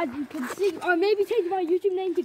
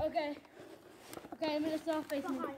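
hushed; 3 semitones lower, 12 dB quieter than usual. Pretend. Hiding on patrol, giving orders.

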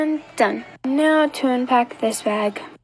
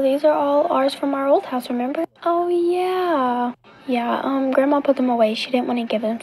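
usual speaking voice

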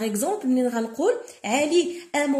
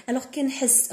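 ordinary talk

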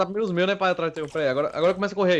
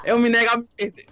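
dramatic defiance; 5 semitones higher, 6 dB louder than usual. Pretend. Resistindo na luta do jogo.

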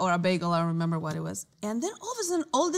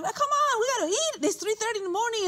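coaxing friend; 13 semitones higher, much faster than usual. Retold or imitated. Friend urging Agnes to stay and eat the cake, high coaxing. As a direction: lift the voice high to cajole.

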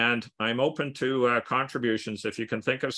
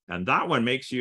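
ordinary talk, nothing marked

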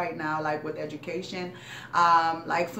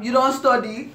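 louder, higher laughing scold; mock stern dad; 6 semitones higher, 8 dB louder than usual. Dad ignores broken arm, loud laughing mock scold. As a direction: brush it off as the dad.

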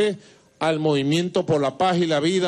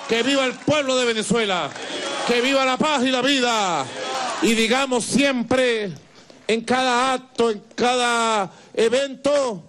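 raised rally speech, rising pitch; booming balcony speech; 6 semitones higher than usual. Real, not acted. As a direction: rouse the crowd like a political rally. Rousing closing of a rally speech.

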